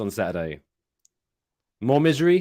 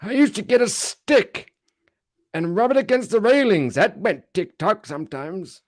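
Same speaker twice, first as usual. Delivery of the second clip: put-on cranky old man voice; 11 semitones higher, 6 dB louder than usual. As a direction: play a doddering old geezer. Mocking old people scolding kids.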